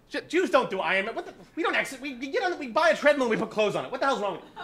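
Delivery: high, incredulous mock dad; dad scolding. Dad scolding his son, high and mock outraged. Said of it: "My dad always had a saying".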